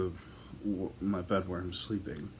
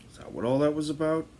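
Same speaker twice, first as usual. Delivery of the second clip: higher, louder and fast, incredulous; exasperated outburst; 8 semitones higher, 6 dB louder, faster than usual. Retold or imitated. Son to mom about her midnight visit, exasperated and fast. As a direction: blurt it out in disbelief.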